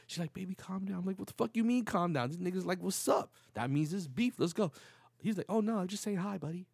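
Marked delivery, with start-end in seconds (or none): 0.3-1.0 higher, softer retold plea; lightly voiced girlfriend. Girlfriend soothing him in retold quarrel. 1.2-4.7 high-pitched, worked-up comeback; retold indignant protest. Acts out his confrontational reply. 5.5-6.7 higher-pitched retold backpedal; lightly voiced apologetic stranger. Stranger backing off in retold quarrel.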